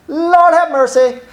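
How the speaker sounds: high, slow drawn-out gasp of shock; shocked exclaim. Retold or imitated. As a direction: stretch it out in disbelief. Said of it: "And I go".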